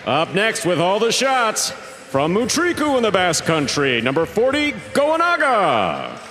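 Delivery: big ring-announcer delivery, high-pitched with drawn-out names; arena announcer. Acted. Announcer introducing the teams to the fans, booming showman delivery. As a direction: stretch each player's name out like a proud arena announcer.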